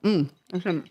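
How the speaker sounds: talking through a mouthful of snack; mouth full. Real, not acted. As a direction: say it with your mouth full, chewing. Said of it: "I'm talking with my mouth full".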